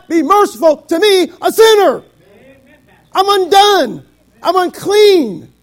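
high, shouted pulpit cadence with punched phrases; full-force preaching. Real, not acted. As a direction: cry it out to the congregation, drawing out the last words.

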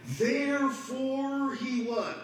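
Therefore drawn out high and slow, waiting for the answer; call and response. Shown as a demonstration. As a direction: stretch out Therefore he what and leave it hanging.

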